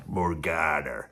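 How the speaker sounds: low, slow, drawn-out film-star voice; Kirk Douglas impersonation. Pretend. Vlogger doing his Kirk Douglas for the camera, low and slow. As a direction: stretch the name out low like an old Hollywood hero. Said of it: "That's my Kirk Douglas impersonation".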